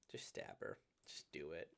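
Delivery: low, hushed mock urging; half-whispered, casual nudge; hushed aside. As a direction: mutter it low like a guilty suggestion. Deadpan aside, urging to kill.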